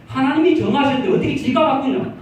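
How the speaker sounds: loud, high indignant retort with a laugh; strong retort. Retold or imitated. Deaconess retorting loudly to the pastor's suggestion. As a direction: fire back that no one may change it. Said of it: "얼마나 강하게 말씀하시는지".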